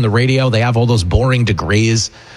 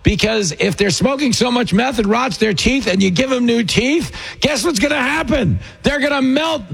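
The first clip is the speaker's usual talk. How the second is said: heated rant; 10 semitones higher than usual. Real, not acted. Ranting about the dental plan.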